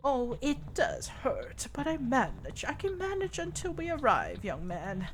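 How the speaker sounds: a frail grandmother easing her pain; old grandma. Acted. Injured grandma speaks to the young paramedic, lightly voiced old lady.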